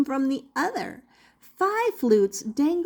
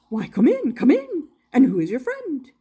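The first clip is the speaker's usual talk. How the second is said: a hint of a jolly, louder showman; lightly voiced jolly inventor; 3 semitones higher, 5 dB louder than usual. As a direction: greet the guests like a cheery showman. Doctor Marvel's voice.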